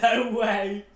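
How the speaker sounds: a high, loud, laughing "no way"; half-laughing, stunned; disbelief. Retold or imitated. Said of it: "I was like".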